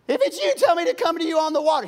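high, loud and fast demand; brash Peter again. Acted. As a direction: insist on proof before stepping out. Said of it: "He wants I D first".

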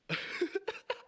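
high giggle; real amused laugh; laughter. Real, not acted. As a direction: burst into a high-pitched laugh. Laughs at own story.

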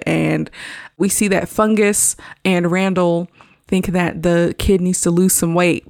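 normal talking voice

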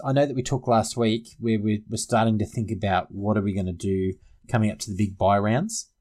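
ordinary talk

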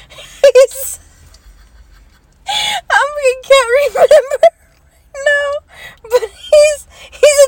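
words squeezed out through laughter; high laughing, barely getting it out; laughing fit. Real, not acted. Laughing fit, can't remember.

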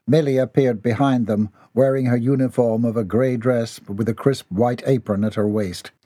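usual speaking voice

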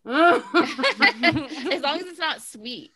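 slow, high, laughing through it. Real, not acted. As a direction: giggle out the words slowly. Laughing while insisting.

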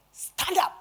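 high-pitched abrupt order; sudden command. Retold or imitated. Preacher retelling the Holy Spirit's order, sudden command. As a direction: order someone up sharply, pitch jumping.

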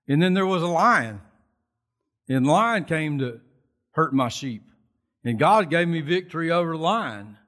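swelling with faith in God; bold declaration. Retold or imitated. David to the army about Goliath, fired-up boast. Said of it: "and he thought".

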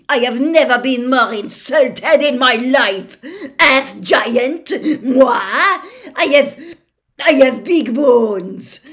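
insulted Madame Maxime raging; foghorn fury. A narrator's reading. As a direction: blast the insult back at him. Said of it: "exploded through the peaceful night air like a foghorn".

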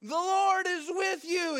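high, slow, loud heroic shout; angel's booming greeting. Acted. Angel peering down at hiding Gideon, grand shouted greeting. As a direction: proclaim it down to someone below.

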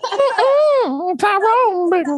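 high, slow squeaky crackhead voice with a laugh; Tyrone Biggums voice. Retold or imitated. Friends riffing on a photo, squeaky crackhead impression. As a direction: squeal the name like the Chappelle crackhead.

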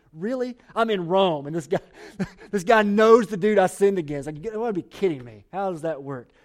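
mock disbelief. Onesimus amazed Paul knows his victim, mock disbelief. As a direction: act it out like you can't believe your luck. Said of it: "You can imagine Onesimus going".

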